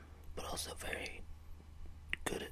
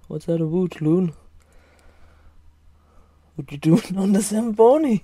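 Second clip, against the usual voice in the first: mock Canadian accent with a Welsh tinge; bad Canadian accent; 25 dB louder than usual. Shown as a demonstration. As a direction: put on a silly Canadian accent that slips Welsh. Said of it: "it's my horrible attempt at a Canadian accent".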